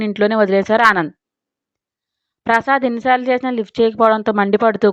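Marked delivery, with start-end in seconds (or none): none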